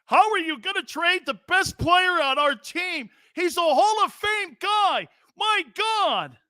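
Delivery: mock outraged fan, high and slow. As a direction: whine like a furious caller who can't believe it. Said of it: "would be going".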